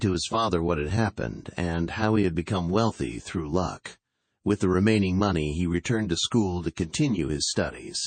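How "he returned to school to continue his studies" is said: plain reading voice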